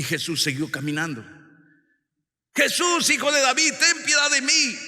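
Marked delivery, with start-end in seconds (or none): 2.5-4.8 loud, high plea for mercy; acted beggar's cry, high and loud. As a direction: shout it after someone walking away. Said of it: "comenzaron a gritar".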